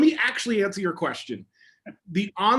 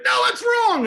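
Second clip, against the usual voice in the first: loud, sharply raised "No, that's wrong"; sharp correction; 9 semitones higher, 7 dB louder, slower than usual. Retold or imitated. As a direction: snap the correction at the student, too heated. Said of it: "I got a little, a little, uh, getting, getting excited".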